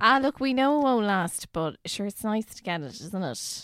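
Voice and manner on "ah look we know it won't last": brash and loud; loud breezy. Real, not acted. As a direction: throw it out loudly. Loud mock bravado.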